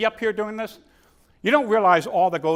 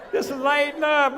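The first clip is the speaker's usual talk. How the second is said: normal talking voice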